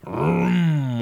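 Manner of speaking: long, drawn-out revving vroom; engine vroom. Acted. Dump truck racing round a curve, revving vroom. Engine noise read from story.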